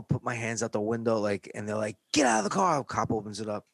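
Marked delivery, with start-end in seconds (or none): 2.1-2.8 high loud command; cop order. Retold or imitated. Cop ordering the boys out of the car, loud high.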